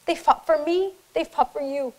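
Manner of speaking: trying to speak through rising tears; choking up. Real, not acted. Veteran honouring the fallen, voice breaking with tears. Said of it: "these guys have never seen me cry before".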